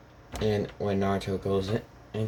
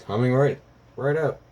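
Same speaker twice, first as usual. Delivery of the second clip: loud, higher-pitched order call; 4 semitones higher, 6 dB louder than usual. Acted. Shop worker answering Naruto's food order, calling out. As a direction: sing out brightly to the customers.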